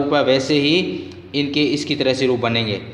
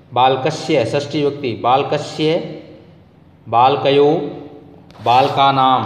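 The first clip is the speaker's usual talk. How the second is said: rote recitation. Shown as a demonstration. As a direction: recite each form clearly for the class. Reciting declension table aloud.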